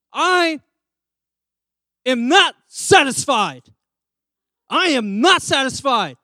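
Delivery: a loud, slow put-upon complaint; demanding guest. Retold or imitated. Hotel guest complaining to staff, loud and slow. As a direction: drag out not satisfied like a fussy hotel guest.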